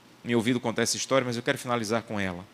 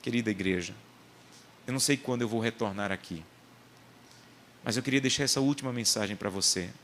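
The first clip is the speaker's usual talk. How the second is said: ordinary talk, nothing marked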